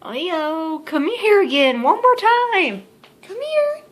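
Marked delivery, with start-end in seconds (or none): 1.8-3.8 higher, sing-song calling; calling the cat. Real, not acted. Owner calling her cat Leo to come back, higher call. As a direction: call the cat over sweetly.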